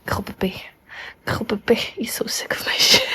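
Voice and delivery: hushed unvoiced aside; soft whispered aside. Real, not acted. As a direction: whisper it so the cat won't mind. Whispering after cat reacts.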